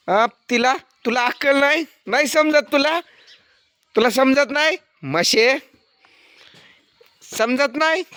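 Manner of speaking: high, sharp scolding questions at an animal. Real, not acted. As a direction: tell the buffalo off. Scolding the buffalo Laxmi.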